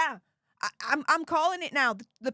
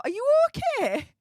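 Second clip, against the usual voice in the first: much higher and louder, teasing worry; mock concern; 11 semitones higher, 6 dB louder than usual. Streamer teasing a viewer who asked a silly question, mock concern. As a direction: ask it like you're pretending to fret over a friend.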